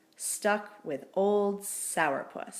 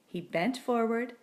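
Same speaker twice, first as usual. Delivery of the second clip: ordinary delivery, nothing marked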